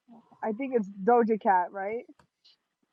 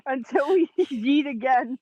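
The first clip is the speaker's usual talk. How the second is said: laughing; 3 semitones higher, 4 dB louder than usual. Real, not acted. Laughing through the sign-off.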